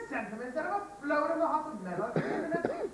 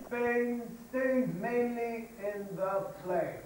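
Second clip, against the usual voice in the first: very slow drill; much slower than usual. Shown as a demonstration.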